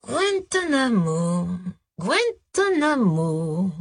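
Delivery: slow, drawn-out, searching memory; musing repetition. A narrator's reading. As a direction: stretch the word out as if recalling. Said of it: "répéta-t-il comme s'il fouillait dans sa mémoire".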